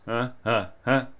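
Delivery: short breathy pushes from the belly; diaphragm pushes. Shown as a demonstration. Teacher shows a student diaphragm articulation, short pushed huhs. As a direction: push each huh from the diaphragm. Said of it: "a short pushed note with the player's diaphragm".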